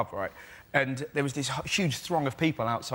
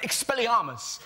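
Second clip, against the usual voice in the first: higher-pitched mock spell cry; little kid; 8 semitones higher, slower than usual. Little kid answering the drunk with a spell, high mock cry. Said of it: "this little kid just went".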